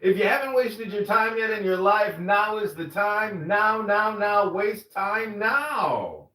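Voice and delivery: hammed-up host welcoming the audience; mock show intro. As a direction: overblown podcast announcer.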